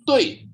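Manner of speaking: TUI high and falling, fourth tone; nada empat. Shown as a demonstration. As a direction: drop "Tui" from the top like a slide. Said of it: "turun seperti jatuh". ("Tui" turun meluncur dari atas)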